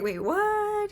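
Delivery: high, startled "wait, what"; sudden alarmed double-take; shocked. Retold or imitated. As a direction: jolt of disbelief. Shocked reaction.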